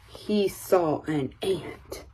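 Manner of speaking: ordinary delivery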